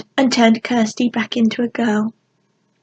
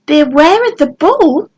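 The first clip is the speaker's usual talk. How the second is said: loud and higher, like reading a warning sign; raised voice; 5 semitones higher, 6 dB louder than usual. A narrator's reading. Fairy calling a fake warning to the goblins, loud. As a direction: call it out for the goblins inside to hear. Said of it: "Georgia raised her voice".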